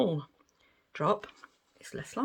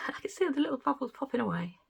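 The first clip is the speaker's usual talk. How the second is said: delighted giggle; 7 semitones higher, faster than usual. Real, not acted. Watching resin bubbles pop.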